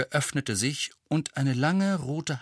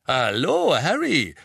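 louder, swooping cheerful hello; beaming greeting; 4 semitones higher, 5 dB louder, slower than usual. A narrator's reading. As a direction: greet him with a big beaming grin. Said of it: "sagte George und strahlte ihn an". (strahlend fröhliche Begrüßung)

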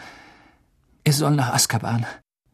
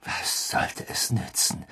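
scornful whisper; 9 dB quieter than usual. Acted. Sirius echoes mockingly.

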